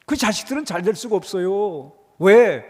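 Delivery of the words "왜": usual speaking voice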